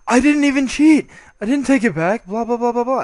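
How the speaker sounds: loud, high imitation of an indignant opponent; hammed-up loud whining denial; mock outraged protest. Retelling opponent protesting cheating accusation.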